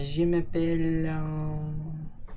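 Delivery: a lightly put-on French accent; playful mock French accent, drawn out. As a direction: toss out some fake French, hesitant, slowly. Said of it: "That accent was very good".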